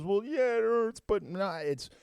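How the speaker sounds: high pained voice, imitating the wife; quoting her answer through the pain; wife's quote. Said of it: "She goes".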